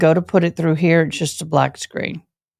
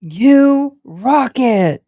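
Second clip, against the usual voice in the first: slow, higher emphatic praise; drawn-out praise; 6 semitones higher, 3 dB louder, much slower than usual. Real, not acted. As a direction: stretch it out like real admiration. Repeating praise, slow and high.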